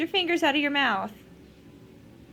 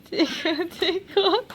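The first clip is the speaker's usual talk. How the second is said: playful rising tickle chant; giggly tickle attack; 5 semitones higher, 4 dB louder, slower than usual. Acted. Mother tickling baby again.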